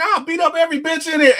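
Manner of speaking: high, quick mock threat; imitated rapid-fire delivery. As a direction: imitate her, rattling it off high and fast. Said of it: "the way she delivers it so quickly".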